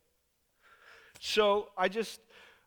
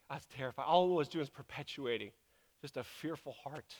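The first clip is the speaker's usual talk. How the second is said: ordinary talk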